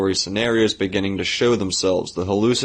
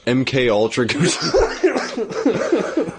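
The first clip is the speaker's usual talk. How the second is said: drawn-out hype; 7 semitones higher, much slower than usual. Pretend. Hyping the silly name.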